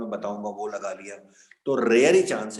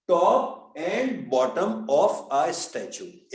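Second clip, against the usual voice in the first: very slow and higher-pitched, each word separate; broken-up, unhurried reading; 6 semitones higher, much slower than usual. Shown as a demonstration. Teacher pointing out the object to students, slow and broken up. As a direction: space out "top and bottom of a statue". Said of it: "तोड़ के पढ़ो आराम से".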